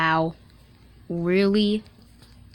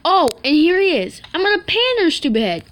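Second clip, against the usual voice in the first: excited; 7 semitones higher, 8 dB louder than usual. Real, not acted. Spots enemy, charges with pan.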